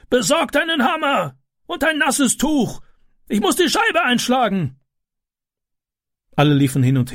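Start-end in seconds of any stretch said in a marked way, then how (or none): 0.1-4.8 much higher, urgent barking orders; a man directing a rescue; commanding neighbour. A narrator's reading.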